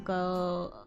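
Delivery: ordinary talk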